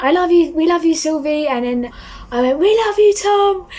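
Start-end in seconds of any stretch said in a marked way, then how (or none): 0.0-1.5 high, loud fan call; retold shout. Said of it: "and she went". 2.6-3.7 very high, loud fan shout; top of my voice. Retold or imitated. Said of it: "at the top of my voice".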